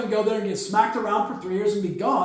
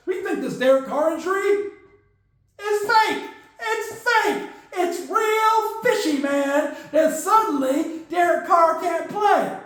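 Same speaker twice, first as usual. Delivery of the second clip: high, worked-up, ending in a shout; indignant coach; 7 semitones higher, slower than usual. Retold or imitated. Saints front office accusing Carr of faking, high and rising to a shout. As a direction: build suspicion into an outburst. Coach claims injury is fake.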